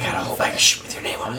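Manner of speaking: quick hushed whisper; fast whisper. Acted. Whispered joke in a hushed scene.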